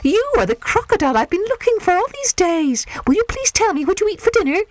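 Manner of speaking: put-on high, eager excited child. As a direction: burst with excitement. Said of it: "grew very excited".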